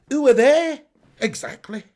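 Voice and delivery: high, loud mimicked question, then a dry answer; old advert impression. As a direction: act out a remembered TV advert exchange. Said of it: "Who's old enough to remember that advert?".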